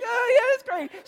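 high, singsong fake approval; hammed-up "oh, great"; mock enthusiasm. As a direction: feign delight with the pitch shot way up. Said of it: "I'm like".

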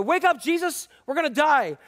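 mock-alarmed shout to wake someone up; scared disciples. Disciples waking Jesus in the storm, scared. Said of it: "the disciples are scared".

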